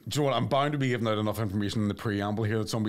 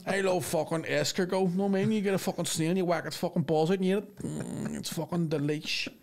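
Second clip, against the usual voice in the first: lightly voiced spide, showing off refined tastes; mock-savouring Belfast lad; Belfast lad gourmet; 7 semitones higher than usual. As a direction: imitate a lad relishing fancy food.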